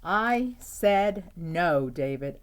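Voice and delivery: very slow, loud stern mother; exasperated mom. Acted. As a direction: drag it out like a fed-up mom. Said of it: "David's mom always said".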